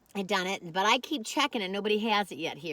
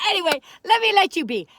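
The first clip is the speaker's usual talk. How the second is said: laughing wrap-up; 8 semitones higher, 10 dB louder than usual. Real, not acted. Laughing as she wraps up.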